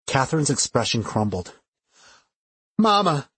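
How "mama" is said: high, distressed cry for mother. A narrator's reading. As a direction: call out tearfully for your mother. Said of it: "she cried".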